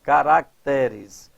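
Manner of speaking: "Caracteres" slowly, leaning on TE; stress on TE. Shown as a demonstration. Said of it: "passa até". ("Caracteres" devagar, com a tônica no TE)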